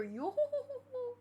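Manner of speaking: mock pirate skeleton laugh, high and breathy; Brook's laugh. Imitating the skeleton's signature laugh for the viewer, mock laughing. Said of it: "'cause of the laugh, you hear".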